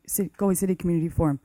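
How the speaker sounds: normal talking voice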